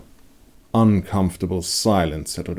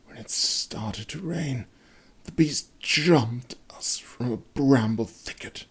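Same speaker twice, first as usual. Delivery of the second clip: slow haunted whisper; wounded man whispering the moment of the attack; fearful whisper; 6 semitones higher than usual. A narrator's reading. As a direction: whisper it as if it could hear.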